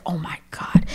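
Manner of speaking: unvoiced under-the-breath grumble; whispered dad grumble; grumbled whisper. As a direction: mutter it to yourself, annoyed. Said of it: "he's grumbling to himself".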